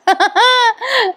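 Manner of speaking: high laugh falling away; real laugh. Real, not acted. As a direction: laugh at the silly joke. Narrator laughs.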